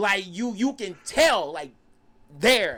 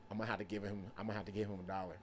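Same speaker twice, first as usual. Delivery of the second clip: very soft, low murmur; tense low reply; 11 semitones lower, 16 dB quieter, faster than usual. Retold or imitated. Storyteller replaying his reply to uncle, tense low murmur. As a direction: keep it hushed and low, under control.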